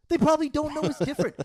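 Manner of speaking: loud, high exasperated cry; real ranting frustration at full volume; exasperated outburst. Real, not acted. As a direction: burst out over the laughter, pitch way up. Heated rant over friend's laughter.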